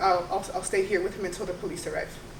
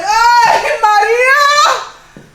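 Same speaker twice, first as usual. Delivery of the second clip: high, loud, overjoyed cry of a friend's name; ecstatic greeting; 14 semitones higher, 16 dB louder, much slower than usual. Acted. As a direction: burst in thrilled to see her friend. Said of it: "busts through the door and she's like".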